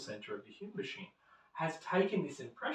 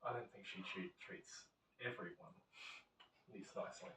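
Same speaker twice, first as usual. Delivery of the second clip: lightly acted, hushed self-flattering thought; musing ego voice; 6 semitones lower, 15 dB quieter than usual. Ego convincing the man the saleswoman favours him, hushed musing. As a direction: quietly reason it out to yourself, soft and low. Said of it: "the ego grabs it and goes".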